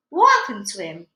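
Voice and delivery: loud, alarmed splutter; father panicking about his own hat, loud. Acted. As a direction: blurt it out in panic. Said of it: "he spluttered".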